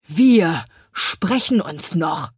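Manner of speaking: slow, ominous gloating baker; slow parting threat. Acted. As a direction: drag out the warning.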